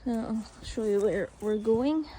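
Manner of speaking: ordinary talk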